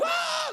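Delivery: high-pitched mock child scream; kids' excited squeal. Imitating kids seeing the host, excited squeal. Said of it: "Kids are like".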